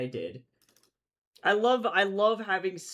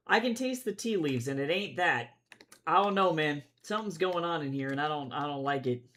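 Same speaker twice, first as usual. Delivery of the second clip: ordinary talk, nothing marked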